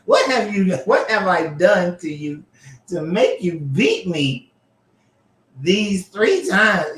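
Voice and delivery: high, slow put-on donkey voice; talking donkey voice. Reading the donkey's complaint to Balaam in a high, slow donkey voice. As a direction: plead like a wounded little donkey. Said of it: "doing the little donkey sound".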